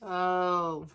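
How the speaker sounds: plain reading voice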